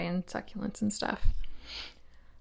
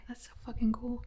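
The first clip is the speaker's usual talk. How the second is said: whispering; 8 dB quieter, faster than usual. Real, not acted. Awed by the spider.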